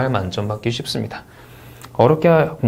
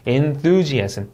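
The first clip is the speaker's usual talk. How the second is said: "enthusiasm" slow with a marked stressed syllable; careful word stress; much slower than usual. Shown as a demonstration. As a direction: stretch the stress on THU in "enthusiasm". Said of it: "강세 주의에서 한번 단어 읽어볼게요". ("enthusiasm" 강세 살려 천천히)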